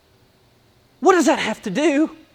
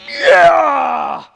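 mock excited yell; 3 semitones higher, 4 dB louder than usual. Mocking enthusiastic man.

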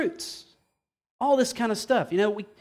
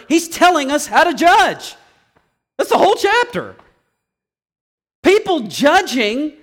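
high, raised, forceful pulpit delivery; impassioned preaching; 4 semitones higher, 4 dB louder than usual. Real, not acted. Preacher argues from Scripture that we judge, fired-up preaching. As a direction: drive the argument home at full force. Heated preaching against not judging.

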